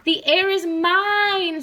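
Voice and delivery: shrieking claim; frog screaming. Acted. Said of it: "screamed Lydia".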